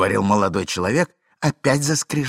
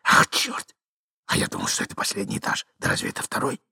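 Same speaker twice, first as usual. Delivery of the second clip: put-on annoyed young man's whisper; hurried, exasperated acted whisper; 10 semitones higher than usual. A narrator's reading. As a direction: curse under your breath. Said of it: "прошептал молодой человек".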